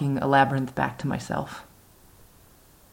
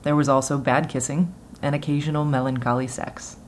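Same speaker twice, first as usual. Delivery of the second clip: plain reading voice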